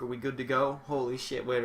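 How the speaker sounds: ordinary talk, nothing marked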